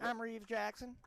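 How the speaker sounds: high-pitched mocking impression of a colleague; Reeves Jackson. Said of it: "That's how you sound".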